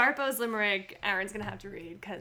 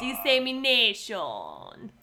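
Spanish accent; much slower than usual. Shown as a demonstration.

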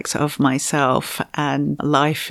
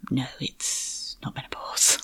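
softly whispered news, a giggle under it; hushed retold doctor; 2 semitones lower, 9 dB quieter than usual. Doctor softly whispers the surprising test result. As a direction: breathe the verdict half under your breath.

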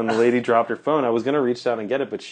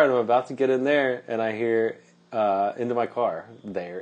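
usual speaking voice

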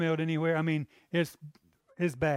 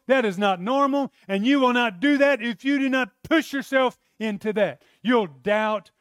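higher and louder, driving each point home; preaching at full force; 6 semitones higher, 5 dB louder than usual. Acted. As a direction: preach it with rising fervor to the church. Preacher exhorting the congregation.

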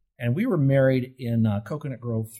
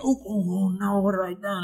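1 mock panic; 7 semitones higher than usual. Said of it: "Undo means, you".

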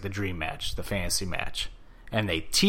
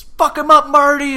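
fan yell; 13 dB louder than usual. Retold or imitated. Fan cheering again, retold.

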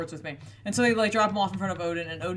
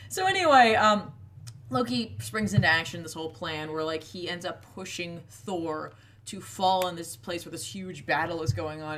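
normal talking voice

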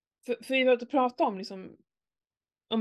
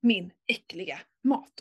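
mock-sweet, sinking into a whisper; sugary sarcasm, then hiss the last words; sarcastic sweetness; 4 semitones lower than usual. Mother retelling her sarcastic reply to her son, whispered. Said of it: "Jag bara".

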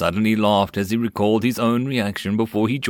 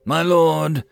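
raised, louder brassy address; bold, brassy and higher; 8 semitones higher, 5 dB louder than usual. A narrator's reading. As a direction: ring out like a proud soldier. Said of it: "the man's brassy voice said".

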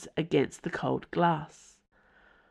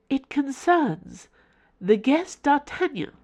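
creepy formal house; 9 semitones higher than usual. Acted. The house informing Mother in a creepy formal tone. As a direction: announce it like a cold formal servant.

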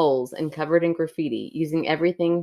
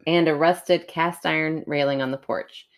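story narration. Acted. Reads a creepy story aloud.

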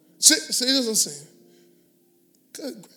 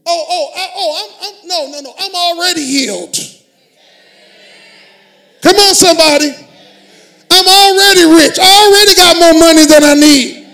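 loud, higher, triumphant proclamation; shouted decree; 4 semitones higher, 15 dB louder than usual. Shown as a demonstration. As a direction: declare it at full force. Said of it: "You gotta decree it".

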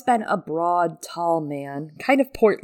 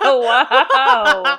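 laughing wow; 7 semitones higher, 10 dB louder than usual. Real, not acted. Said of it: "friend teases her".

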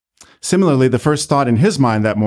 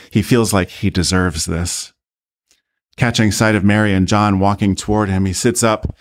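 usual speaking voice

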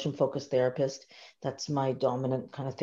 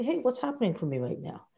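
higher, softer self check-in; inner voice; 7 semitones higher, 4 dB quieter than usual. Pretend. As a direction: ask yourself gently, higher pitch. Self-talk during a pause.

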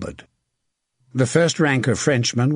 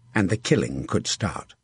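ordinary delivery, nothing marked

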